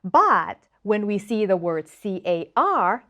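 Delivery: ordinary talk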